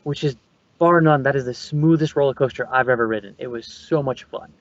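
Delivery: normal talking voice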